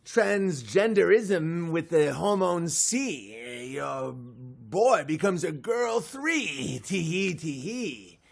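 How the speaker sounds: a trickster sprite slowly reciting its rhyme; leprechaun rhyme. Acted. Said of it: "Be like".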